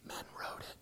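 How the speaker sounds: a breathless unvoiced aside; whispered aside. Real, not acted. Telling the audience the answer, whispered. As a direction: whisper it as a dry punchline. Punchline aside about translators.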